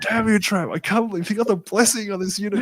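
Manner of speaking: high, put-on whinge at a rival; hammed-up outraged opponent voice; mock whiny complaint. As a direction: whine it like a sore loser. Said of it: "And I was like".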